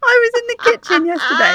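high, breaking into laughter; laughing so hard the words go high. Real, not acted. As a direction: talk through real giggles. Laughing at her own story.

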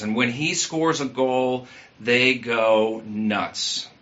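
ordinary talk

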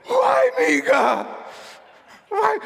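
high, loud wail; anguished cry to God. Retold or imitated. Sleepless man crying out to God. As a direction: cry out to heaven in despair.

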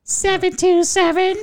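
pleasant voice. Shown as a demonstration. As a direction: coo the number sweetly up high. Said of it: "give out the number pleasurably".

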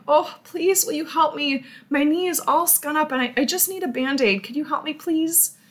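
a hint of a tearful child begging for help; crying child. Hurt neighbor kid asking the homeowner for a Band-Aid, mock tearful and high. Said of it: "They're crying and".

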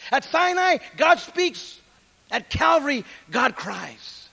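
loud, rising pulpit cadence; preacher driving home Sinai against Calvary, full force; full-force preaching. Acted. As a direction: preach it out to the back pews. Preaching climax to congregation.

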